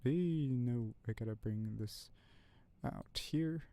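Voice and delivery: ordinary talk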